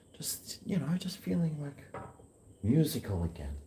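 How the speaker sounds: soft wistful whisper. Acted. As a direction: breathe it out wistfully.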